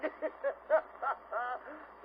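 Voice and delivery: high string of short chuckles; a storyteller's high, staccato laugh. Acted. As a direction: chortle high at your own joke. Laughs at his own joke.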